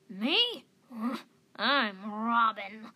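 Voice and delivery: put-on old cat announcing herself with puffed-up chest; proud old cat. A narrator's reading. Old cat introducing herself to youngsters, croaky and proud. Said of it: "puffing out her chest a little bit".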